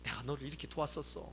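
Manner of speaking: a hint of a fond mother; mother's diary voice. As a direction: read it like a loving mother's diary. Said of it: "엄마들의 육아일기처럼".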